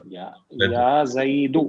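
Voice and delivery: يا زيدو said slowly, drawing out each word; slow model answer for pupils to repeat; slowly spelled out. Shown as a demonstration. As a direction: stretch out یا and زیدو for the class. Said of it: "یا plus زیدن کیا ہو جائے گا".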